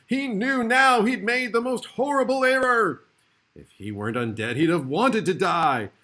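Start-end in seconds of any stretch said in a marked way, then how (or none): 0.1-3.0 much higher, much louder put-on voice of dread; hammed-up horror at his mistake; telling of the little vampire's terror with mock fright; narrated terror. A narrator's reading. Drac terrified, narrated.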